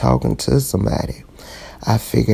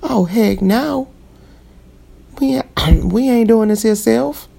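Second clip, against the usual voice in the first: fed-up self-talk; 12 semitones higher than usual. Retold or imitated. Telling herself firmly she won't lose her worth. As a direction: put your foot down with yourself, pitched up. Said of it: "And I'm like".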